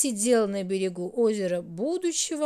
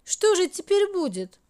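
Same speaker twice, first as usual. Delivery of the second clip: tearful; 8 semitones higher, 4 dB louder than usual. A narrator's reading. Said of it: "всхлипнула Минутка".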